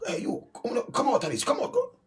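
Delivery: high, loud barked order; mock cop yell. Police officer ordering driver out of the car, mock yelling. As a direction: yell it like a cop pulling him out.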